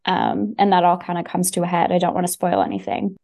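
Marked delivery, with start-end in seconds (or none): none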